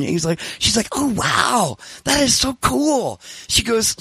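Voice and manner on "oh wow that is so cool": put-on high, drawn-out gushing girl voice; imitated girlish admiration; impressed young woman. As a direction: gush slowly like an impressed girl.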